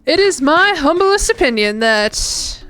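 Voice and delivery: slow, drawn-out Louisiana bayou drawl; old Southern accent. Pretend. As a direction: put on an amateur old Southern accent, taking it slowly. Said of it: "like, Louisiana Bayou, like, kinda old Southern".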